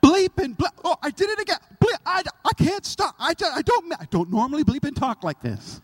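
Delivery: high, loud, fast, sputtering with laughter; flustered guy. Retold or imitated. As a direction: trip over the words in a panic. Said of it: "he says".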